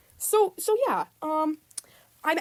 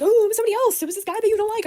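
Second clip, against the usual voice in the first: high, louder mock protest of innocence; mocking the girl's voice; 9 semitones higher, 5 dB louder, faster than usual. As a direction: parrot her innocent excuse, hammed-up. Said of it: "she was like".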